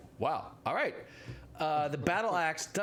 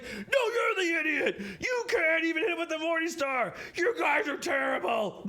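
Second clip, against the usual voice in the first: shrieking angry retort; 13 semitones higher, 5 dB louder than usual. Acted. Second ettin head shrieking back at the first after a miss. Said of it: "he's like".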